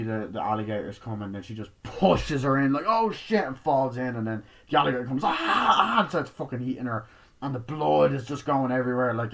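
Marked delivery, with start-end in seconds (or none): 2.9-3.4 startled yelp. Retold or imitated. Pushed into the water. 5.2-6.0 high, loud screams while attacked; screaming. Retold or imitated. Alligator attack.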